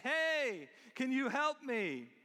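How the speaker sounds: high, loud, drawn-out call; acted shout for help from below; crying out for help. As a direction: call up to someone far above you. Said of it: "crying out for help".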